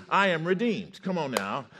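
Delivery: loud, high defiant declaration; full-force preaching. Real, not acted. Preacher voices healed man to Mister Devil, full-force preaching.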